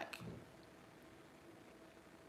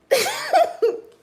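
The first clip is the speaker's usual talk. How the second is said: loud, high burst of laughter; sudden loud high laugh; real laugh; 15 dB louder than usual. Real, not acted. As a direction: break into a high cackling giggle. Laughs before showing eye again.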